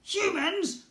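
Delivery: high, loud, disgusted goblin question. A narrator's reading. Goblin prince repelled by humans, high disgusted. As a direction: say it like a bad taste. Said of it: "like it left a bad taste".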